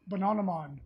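lightly attempted British pronunciation; British accent. Host naming an old British cartoon, in a half-hearted British accent. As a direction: slip into a British "ah" on Bananaman. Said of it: "supposed to be, like, a British accent".

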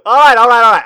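high, loud calling it to order; loud reset. Real, not acted. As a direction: shout to get back on track. Regaining composure loudly.

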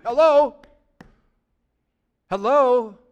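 loud, pointed "Hello?" to wake the room; mock-exasperated call-out; calling out for response. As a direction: demand an answer from the crowd. Prodding a silent congregation.

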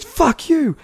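high, loud imitated retort; angry insult back. Driver swearing back at runner, high and loud. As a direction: hurl it back as the irate driver. Driver's reply in retold quarrel.

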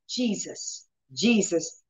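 very soft, whispered name; hushed prayer. Retold or imitated. Speaking the name in pain, hushed and soft. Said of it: "speaking the name".

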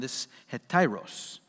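normal talking voice

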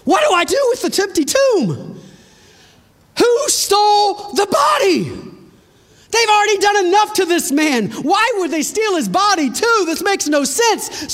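high, anguished questioning; acted dismay, pitched high; distraught disbelief. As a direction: cry out in bewildered protest at the loss. Voicing Mary finding tomb empty.

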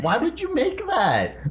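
high, slow disgusted laugh. Real, not acted. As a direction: laugh it out, grossed out. Disgusted after tasting bean.

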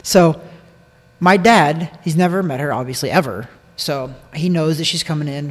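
ordinary delivery

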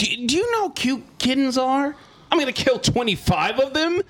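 high, outraged disbelief; can't believe the question; incredulous. Real, not acted. Outraged at killing kittens.

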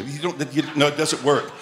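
normal talking voice

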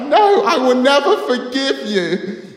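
high, very loud melodramatic refusal; hammed-up angry scream. Boy rejecting the crying girl's apology, screaming dramatically. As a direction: shriek it in mock outrage. Acted quarrel, continuing the screamed confrontation.

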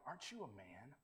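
low, hushed question to oneself; self-challenge. Pretend. Man to his mirror in the morning, quiet self-challenge. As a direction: say it softly to your own reflection. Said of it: "write on our mirrors in the morning".

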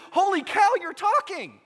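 put-on high, loud astonished cry of a little kid; amazed kid. Preschooler amazed the pig talks, loud astonished shout. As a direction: blurt it out like the child just realised. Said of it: "He said".

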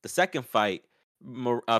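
ordinary talk

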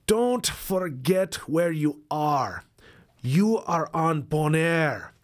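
put-on slow Caribbean accent; drawn-out Caribbean lilt. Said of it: "I'm gonna do my best to do a Caribbean accent".

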